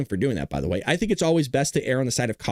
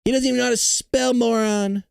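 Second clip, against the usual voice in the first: higher-pitched mimicking of a politician's insults; Donald Trump; 6 semitones higher than usual. President insulting the Fed chief, mock Trump voice. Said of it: "He's just gonna keep saying".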